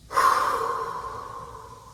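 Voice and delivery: loud weary sigh before a task; heavy sigh. Real, not acted. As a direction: breathe out hard, bracing yourself. Facing difficult bill paying.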